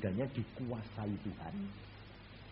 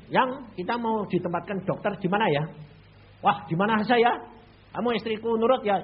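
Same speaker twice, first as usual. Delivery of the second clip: louder, higher eager question to wife; eager husband; 7 semitones higher, 9 dB louder than usual. Retold or imitated. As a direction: ask the wife brightly. Asks wife where posted. (suami bertanya penuh semangat kepada istri)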